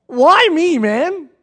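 pleading with God, voice up and loud; Joseph's exasperated plea. Retold or imitated. Joseph crying out to God about his lot, loud and high. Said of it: "did you not sit back and say".

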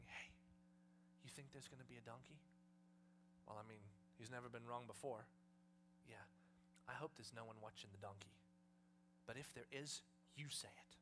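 low, quiet, wary exchange; hushed conferring. Acted. Disciples conferring on the way to the donkey, hushed and low. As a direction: confer softly so nobody overhears.

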